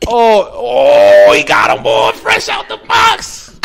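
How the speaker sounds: high, drawn-out mock hype yell; imitating loud, wild Brooklyn hype; hammed-up excited shout. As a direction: holler like an over-the-top hype crowd. Mocking reaction to her Timbs.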